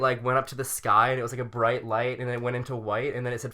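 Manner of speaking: ordinary delivery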